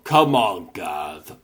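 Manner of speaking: ordinary talk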